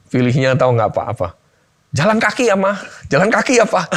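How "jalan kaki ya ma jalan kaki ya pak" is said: put-on a light, much higher child's voice; imitating his son. Son telling his parents they will walk everywhere, lightly voiced child. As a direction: play the kid cheerfully insisting on walking.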